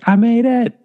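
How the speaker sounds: very high, strained little voice; mock triumphant squeal. Mimes funny picture sent to wife.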